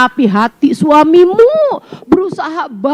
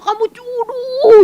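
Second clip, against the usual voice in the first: teasing scold; 8 semitones higher, slower than usual. Acted. Mock scolding, distorted word.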